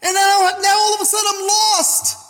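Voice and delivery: acted-out lament of a struggling believer; high-pitched, building complaint; distressed complaint.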